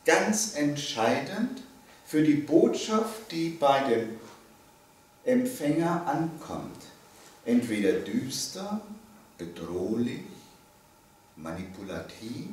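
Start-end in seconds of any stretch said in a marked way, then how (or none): none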